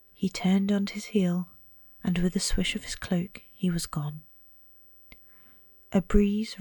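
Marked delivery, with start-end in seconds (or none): none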